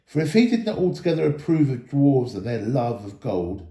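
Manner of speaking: plain reading voice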